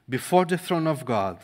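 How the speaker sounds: ordinary talk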